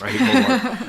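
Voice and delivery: high, laughing protest; laughing admission. Real, not acted. Streamer admitting when they won't jump in, laughing. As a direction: say it through laughter, pitch jumping up.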